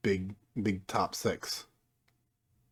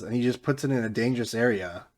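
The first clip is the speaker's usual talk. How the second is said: ordinary delivery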